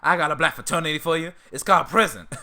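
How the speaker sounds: Uncle Ruckus impression. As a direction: deliver the punchline as a gleeful bigot. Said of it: "I can't do a Ruckus impression for that long".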